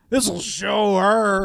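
slow, smug spiteful voice; spiteful young guy. Retold or imitated. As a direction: drag it out like a petty kid. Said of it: "spiteful dabs".

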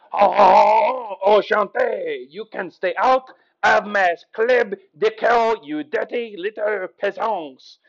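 hammed-up haughty French accent; stretched, loud opening in a mock French accent. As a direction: scold in an exaggerated French accent. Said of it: "Here is my country impressions".

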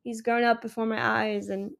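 ordinary delivery

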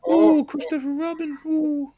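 high, soft squeaky bear voice; Winnie the Pooh impression. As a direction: play the gentle silly bear. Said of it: "You can do a Winnie the Pooh".

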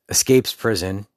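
usual speaking voice

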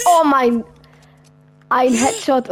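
surprised. Real, not acted. Reacting to close shot.

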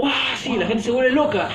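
high, loud hammed-up excitement; mock excitement. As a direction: overreact like a hyped fan. Mocking amazed people over bottle trick.

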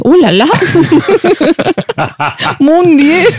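high, hammed-up French exclamations; shocked Frenchman. Imagining a French listener at home, mock-shocked Frenchman. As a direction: play a scandalized Frenchman, laughing between cries. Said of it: "hay un francés en su casa y dice".

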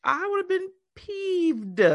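high, slow "peeved"; indignant. Real, not acted. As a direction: drag out the outrage. Reacting to spit story.

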